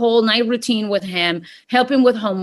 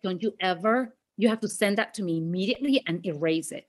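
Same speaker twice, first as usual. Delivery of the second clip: much softer, lower urgent plea; hushed demand; 6 semitones lower, 9 dB quieter than usual. Retold or imitated. Begging her husband to erase the video, hushed. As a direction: press it low and quiet.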